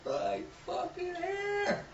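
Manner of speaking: very high, very slow, wailed; wailing answer. Retold or imitated. Wrestler answers friend where the car was, wailing. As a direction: stretch it out in a high wail.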